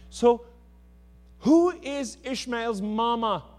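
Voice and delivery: preacher driving the point home to the church, loud and high; full-force preaching. Acted. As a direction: preach it out to the congregation.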